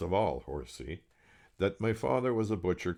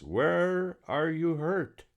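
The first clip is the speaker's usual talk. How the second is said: high, loud and slow, as to a foreigner; loud slow question; 11 semitones higher, 6 dB louder, much slower than usual. Acted. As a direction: spell it out loudly to a foreigner. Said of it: "asked in a loud voice".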